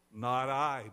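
loud, firm refusal; declaring. A narrator's reading. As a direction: declare it forcefully to the room. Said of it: "declared a voice".

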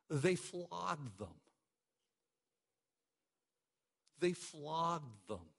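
each word of They flogged them spoken slowly and weighted; slow, deliberate They flogged them; slow emphatic repeat. Real, not acted. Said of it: "Catch a hold of that".

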